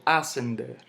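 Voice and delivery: "ascender" hit on the opening A; misplaced stress. Shown as a demonstration. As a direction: punch the first syllable of "ascender" as a wrong example. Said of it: "Yo no digo".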